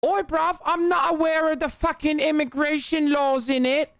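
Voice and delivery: high, rowdy London geezer voice; cheeky London lad sneering; rough London slang. Retold or imitated. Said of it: "I don't mean like".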